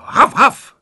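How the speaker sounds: loud, high mock bark; dog barking. A narrator's reading. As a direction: bark at the cat like a little dog.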